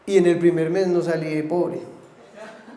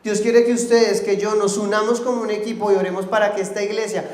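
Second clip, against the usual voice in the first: loud, higher, driving sermon voice; fiery preaching; 4 semitones higher, 6 dB louder than usual. Acted. Pastor exhorting the congregation to pray, fiery preaching. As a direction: preach it full force to the church.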